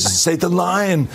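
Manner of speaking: high, drawn-out plea; imitated fan begging for the catchphrase; insistent fan. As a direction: stretch LINE out as an eager demand. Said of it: "one fan came up".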